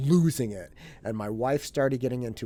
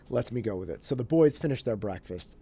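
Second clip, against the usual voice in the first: normal talking voice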